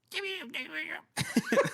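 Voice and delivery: high squeaky ant chirp; little chirping noise. Pretend. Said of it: "a little chirping noise".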